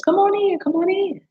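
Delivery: high singsong invitation; calling viewers in. Real, not acted. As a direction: coax them out of the bushes, bright and high. Inviting hidden viewers to join.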